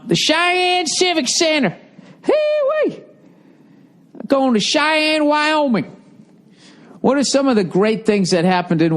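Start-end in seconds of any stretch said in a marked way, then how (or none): 0.1-1.8 very high, drawn-out mock falsetto; goofy falsetto, slowly; silly high voice. Repeats venue name in silly voice. 2.2-5.8 goofy high-pitched whoop; playful falsetto; silly whooping. Pretend. Playful whoop about the trip.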